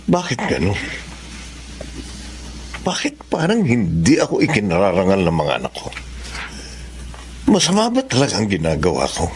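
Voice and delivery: low, quiet reflective thoughts; inner monologue. Acted. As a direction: wonder aloud to yourself, hurt.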